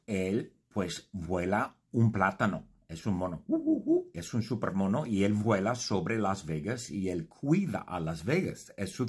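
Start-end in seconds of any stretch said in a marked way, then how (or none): 3.5-4.1 high ape hooting; monkey hoots. Pretend. Telling about Supermono, a high monkey hoot.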